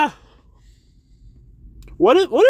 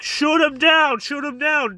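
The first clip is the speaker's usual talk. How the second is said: higher, urgent mock police order; officer yelling; 7 semitones higher than usual. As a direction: shout the command to the squad.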